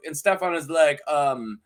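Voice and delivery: ordinary delivery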